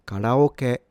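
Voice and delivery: karaoke as ka-ra-o-ke with tapped r; Japanese pronunciation. Shown as a demonstration. Teacher summing up what the word means, Japanese pronunciation. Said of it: "This is pronounced".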